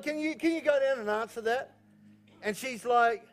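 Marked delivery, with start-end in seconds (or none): 0.0-1.7 high, keyed-up asking voice; retold domestic request. Husband asking Anita to get the doorbell, retold keyed-up request. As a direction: imitate yourself pestering, pitched well up.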